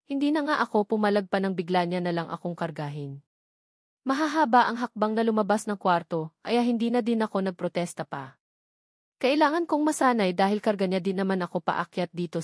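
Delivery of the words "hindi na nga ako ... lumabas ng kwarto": usual speaking voice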